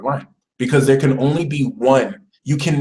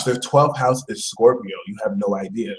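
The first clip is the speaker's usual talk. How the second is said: ordinary talk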